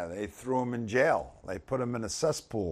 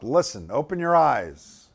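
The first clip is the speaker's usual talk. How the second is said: ordinary talk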